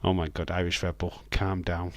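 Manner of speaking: ordinary talk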